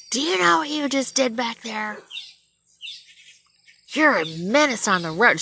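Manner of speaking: high, loud scolding yell; yelling officer. Retold or imitated. Rookie cop berating a driver at her window, yelling. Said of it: "she's yelling at me".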